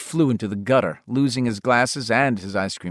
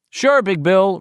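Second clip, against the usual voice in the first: put-on higher, eager kid agreeing at once; meek boy voice; 9 semitones higher, 5 dB louder, slower than usual. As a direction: say it straight, no sass. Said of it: "with no smart mouth at all".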